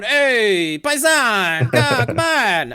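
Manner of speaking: high, loud hammed-up Italian accent; a terrible Italian accent, shouted with joy; exaggerated Italian fan cheer; bad Italian accent. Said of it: "sorry for my terrible accent".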